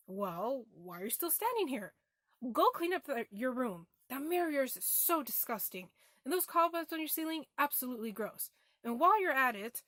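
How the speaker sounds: loud, exasperated nagging mother; acted-out parental telling-off; mom's scolding. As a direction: scold a child about a messy room, voice jumping up loud.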